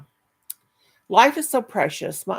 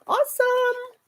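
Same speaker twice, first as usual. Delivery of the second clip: high delighted exclaim; slower than usual. Real, not acted.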